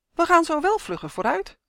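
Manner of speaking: high-pitched, tittering tease; lightly amused, pitched well up; giggly. A narrator's reading. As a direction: say it with a hint of a giggle. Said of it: "giechelde Uf".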